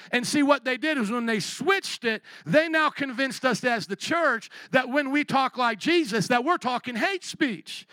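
high, loud pleading preacher cry; fiery preaching. Real, not acted. Preacher pleading with the church about the Pope. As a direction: press the point hard from the pulpit.

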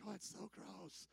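hushed, slow imitation of grossed-out girls; soft, drawn-out recoil at the food; mock-disgusted girls. Girls reacting to sushi at first, mock disgust.

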